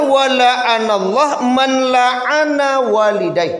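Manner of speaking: slow, high chanted Arabic recitation; hadith recitation. Acted. (bacaan hadis yang dilantunkan)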